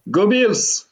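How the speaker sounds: chanted fan rally cry, pitched high; rally the Bills fans with it; cri de guerre. Real, not acted. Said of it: "un petit cri de guerre". (cri de supporters scandé, plus aigu)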